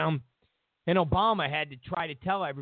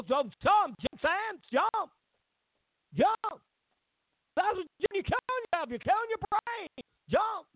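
much higher scolding a student; high stern principal voice; 12 semitones higher than usual. Retold or imitated. Joe Clark lecturing Sam, voiced high and stern.

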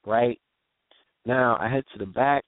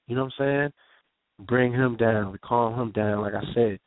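ordinary talk, nothing marked

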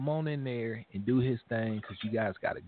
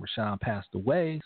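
usual speaking voice

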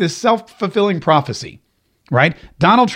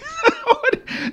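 high short laugh; 6 dB quieter than usual. Real, not acted. Host laughs at irony.

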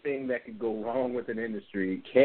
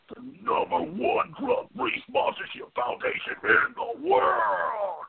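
big hyped wrestling announcer voice; wrestling promo; slower than usual. Acted. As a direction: ham it up like a ring announcer. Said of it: "Get my little wrestler voice".

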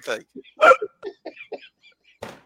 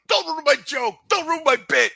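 imitating annoyed coach; 10 semitones higher than usual. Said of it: "he goes".

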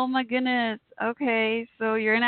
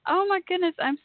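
near tears; 9 semitones higher than usual. Real, not acted. Host greets long-missed Bottom Line Fighter in chat, near tears. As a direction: choke up with joy, voice lifted high. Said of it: "I'm gonna start crying".

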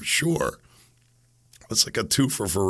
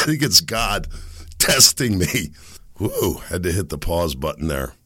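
usual speaking voice

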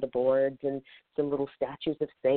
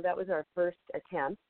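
normal talking voice

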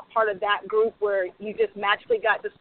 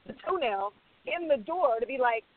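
usual speaking voice

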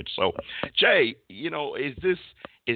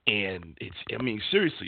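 normal talking voice

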